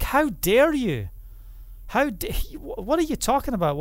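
mocking mourners scoffing at a healer, incredulous; mocking crowd. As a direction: sneer at him in disbelief. Crowd mocks Yeshua.

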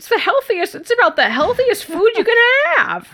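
high squeaky TV-character voice; high squeaky impression of a sitcom character; squeaky voice guy. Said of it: "squeaky voice guy from Superstore".

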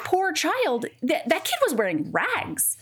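high-pitched, acted disbelief; mock dismayed outrage. Heroine appalled at the child in rags, acted high-pitched dismay. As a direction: play her indignation, pitch jumping up.

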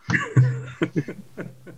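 high-pitched laugh tailing off. Real, not acted. Guest reacts to host's terry toweling hat quip, laughing. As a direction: burst out laughing, high, then let it fade. Reacts to a joke about hats.

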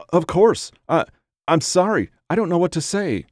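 high, halting, tearful apology; distressed and pitched well up; sobbing. A narrator's reading. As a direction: stammer it out through acted sobs. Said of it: "she sobbed".

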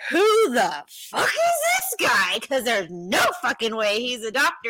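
furious disbelieving yell; angry yell. Retold or imitated. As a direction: shout it like she's ready to fight. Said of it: "And she's like".

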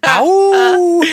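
high loud playful yelp; mock yelp. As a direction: yelp like you got burned. Mock cry after a joke.

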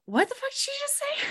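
very high baffled squeak, fast; confused crowd. Retold or imitated. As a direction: squeal it out in disbelief.

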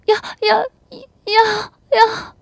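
very high, loud gasped replies; sobbing, high-pitched moans; ecstatic crying assent. Acted. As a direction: cry out each reply. Wife answering at climax.

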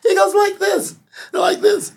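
laughing imitation. Mimics Prabhupada showing the twist.

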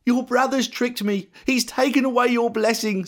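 high-pitched dismay; acted upset old father; distressed. A narrator's reading. Distressed Isaac breaks the news.

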